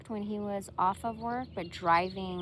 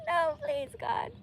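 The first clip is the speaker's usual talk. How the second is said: very high pleading through laughter; laughing. Real, not acted. Begging for mercy while laughing at the profile. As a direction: gasp it out mid-laugh.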